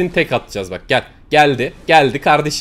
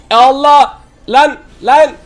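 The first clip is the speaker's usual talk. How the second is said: loud, high panicked yelling; panicked shout; 8 semitones higher, 6 dB louder, slower than usual. Real, not acted. Gamer ambushed in the match, yelling in real panic. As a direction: scream for help as you get ambushed.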